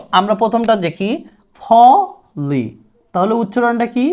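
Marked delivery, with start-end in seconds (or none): 1.6-2.6 "ফলি" slowly split in two, starting on aspirated P. Shown as a demonstration. Teacher showing the first word, slow aspirated P. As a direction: stretch "ফলি" syllable by syllable with a ফ onset. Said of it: "ফর মতো হবে".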